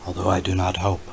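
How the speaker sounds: usual speaking voice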